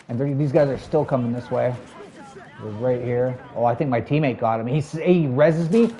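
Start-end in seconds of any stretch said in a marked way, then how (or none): none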